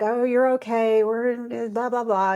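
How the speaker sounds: mock the staff brushing her off; dismissive staff. Surgical staff talking her through it, offhand and dismissive. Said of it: "they were just kinda like".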